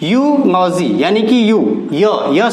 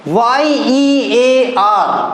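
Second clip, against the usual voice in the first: letters spelled out very slowly; teacher spelling the word slowly; much slower than usual. Shown as a demonstration. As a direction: spell it out carefully. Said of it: "हम इसको भी ईयर पढ़ते हैं".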